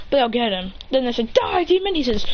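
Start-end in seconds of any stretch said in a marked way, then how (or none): 1.3-1.9 high mock battle yell; acted battle cry. Students attacking the demon, high mock battle cry. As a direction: pitch the threat up high.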